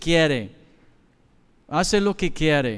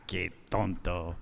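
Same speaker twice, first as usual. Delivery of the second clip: scornful mutter; 10 semitones lower, 12 dB quieter than usual. Retold or imitated. Neighbor scoffing.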